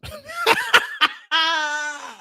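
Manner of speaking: high, wheezing laugh; helpless laughter. Real, not acted. Host cracking up at the accent he put on, helpless high laughter. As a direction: crack up at your own silliness.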